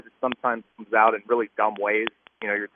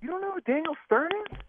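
high-pitched, softer disbelieving question; astonished that anyone could not know; incredulous; 5 dB quieter than usual. Real, not acted. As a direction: voice shoots up in real disbelief. Disbelief at co-host's ignorance.

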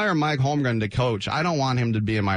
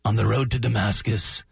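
mock biblical. Host introducing the next show as prophets, mock biblical. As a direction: intone it like a bible title. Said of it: "Doesn't that sound biblical?".

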